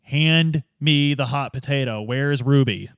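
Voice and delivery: ordinary delivery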